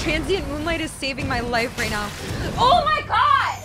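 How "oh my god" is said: high, loud, drawn-out cry; exasperated scream. Real, not acted. Gamer under attack in game, exasperated scream. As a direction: wail it slowly at full volume.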